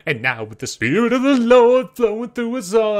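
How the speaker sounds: high, loud Southern preacher, full of the spirit; southern preacher accent. Pretend. Mocking the game character with a Southern preacher accent. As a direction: preach it like a Southern revival pastor. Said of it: "I'll stop doing this accent".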